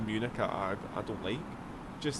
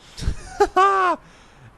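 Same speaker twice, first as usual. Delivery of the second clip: big burst of laughter; 13 dB louder than usual. Real, not acted. Laughing at viewer's question.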